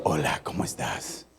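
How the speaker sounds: very soft, low whispered greeting; furtive acted hello, kept in the head; inner-voice whisper. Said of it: "en su pensamiento".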